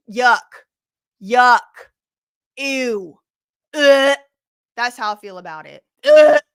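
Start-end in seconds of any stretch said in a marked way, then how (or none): 0.1-3.2 loud sharp yuck; loud disgust. Real, not acted. Streamer recoiling from gross topic, loud yuck. 3.7-4.2 loud groan of revulsion; loud disgusted ugh. Real, not acted. Streamer groaning at gross topic, loud ugh. 6.0-6.4 loud groan of revulsion; loud disgusted ugh. Real, not acted. Streamer groaning at gross topic, loud ugh.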